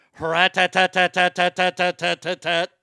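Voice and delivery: loud imitated machine-gun rattle; gunfire noise. Imitating his shotgun blasting home intruders, loud machine-gun rattle.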